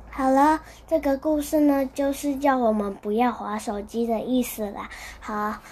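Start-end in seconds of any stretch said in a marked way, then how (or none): none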